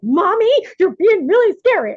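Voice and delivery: put-on high, loud kid complaint; squeaky little-kid whine; child's voice. Mother imitating her children's complaint, high and loud. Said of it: "And they're like".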